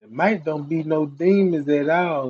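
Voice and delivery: usual speaking voice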